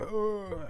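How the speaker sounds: high, soft imitated "ugh"; mock shocked groan. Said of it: "you see her shocked face".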